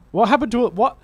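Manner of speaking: sudden high, loud puzzled "What happened"; surprised. Real, not acted. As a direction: caught off guard, genuinely baffled. Confused by the missing plot.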